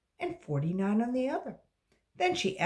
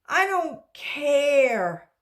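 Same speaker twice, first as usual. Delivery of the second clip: high, loud, slow wail; cried out; 8 semitones higher, 9 dB louder, much slower than usual. Acted. Dana crying out to her teacher, loud and high. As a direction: wail it like a fed-up kid. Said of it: "cried Dana".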